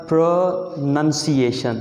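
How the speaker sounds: प्रोनंसिएशन said slowly, each syllable drawn out as pro-nun-si-ae-shun; slow careful pronunciation. Shown as a demonstration. Teacher to students, slowly modelling the correct word. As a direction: spell out प्रोनंसिएशन slowly for learners. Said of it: "slow slow मैंने करके pronounce कर दिया".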